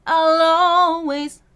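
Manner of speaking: "I'll always" sung slow and loud, L carried into "always"; linked L. Shown as a demonstration. Coach demonstrating linking. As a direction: join the L into the next word. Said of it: "link the L to the always".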